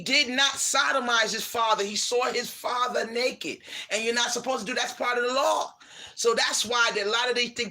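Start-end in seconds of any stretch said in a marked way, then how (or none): none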